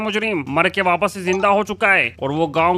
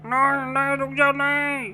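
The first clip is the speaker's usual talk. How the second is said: high panicked pleading; 8 semitones higher, slower than usual. Acted. Guard pleads with mouth stuffed.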